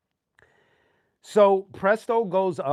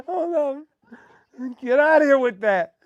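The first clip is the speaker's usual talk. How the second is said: laughing; 7 semitones higher than usual. Real, not acted. Laughing fit.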